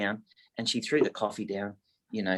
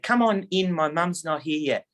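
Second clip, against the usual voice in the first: put-on higher, boyish voice, slowing on the second half; young son's voice; 6 semitones higher than usual. Son welcoming the agent inside, in a higher boyish voice. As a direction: play the eager young son at the door.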